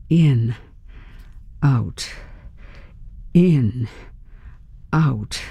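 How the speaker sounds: low, single words spaced far apart; steadying yourself, one word per breath; measured breathing count. A narrator's reading. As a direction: pace each word with a slow breath. Focused breathing to steady herself.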